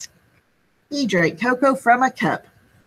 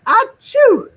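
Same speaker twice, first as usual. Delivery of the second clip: big loud high sneeze; giant sneeze; 6 dB louder than usual. Acted. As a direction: sneeze it out hugely. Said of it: "sneezed a gigantic".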